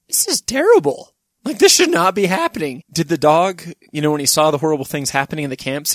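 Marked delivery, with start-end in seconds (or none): 0.1-2.8 high-pitched, emphatic mock dismay; appalled dog. Dog seeing the camps, high appalled protest. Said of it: "tone-deaf dog would be like".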